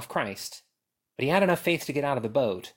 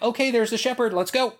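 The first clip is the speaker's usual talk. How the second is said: eager sheep; 10 semitones higher, 4 dB louder than usual. Retold or imitated. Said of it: "Sheep are like".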